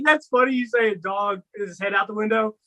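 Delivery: normal talking voice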